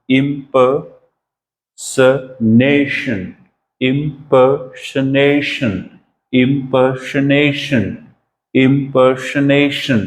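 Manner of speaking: impersonation very slow, stress on the accented syllable; stressed pronunciation. Shown as a demonstration. Teacher showing students how impersonation is said, very slow with stress. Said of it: "तो कैसे बोला जाएगा?".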